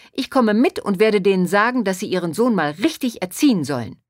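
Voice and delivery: loud, high, indignant outburst; agitated scolding. A narrator's reading. Pharmacist threatening to scold the boy's parents, agitated. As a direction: rant on, worked up. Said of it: "die aufgeregte Stimme der Apothekerin".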